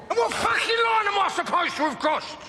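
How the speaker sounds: furious outburst. Acted. Alfie rages at Tommy over the line he supposedly crossed. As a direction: throw the accusation back in his face.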